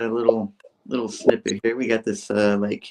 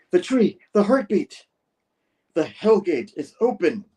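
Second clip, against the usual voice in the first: high, slow, portentous delivery; hammed-up proclamation; 9 semitones higher, 4 dB louder than usual. Cameraman announcing the Hellgate, slow theatrical high voice. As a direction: proclaim it slowly like a doom-laden revelation.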